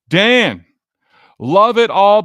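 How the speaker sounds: loud, happy shout-out to a caller; excited greeting. Real, not acted. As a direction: greet him like a big fan. Greeting a listener with gusto.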